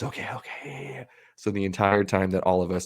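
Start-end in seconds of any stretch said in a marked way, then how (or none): 0.0-1.0 timid whisper. Retold or imitated. Anxious kid quoted.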